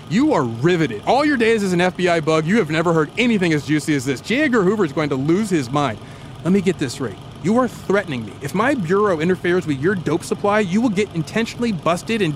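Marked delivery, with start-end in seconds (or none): none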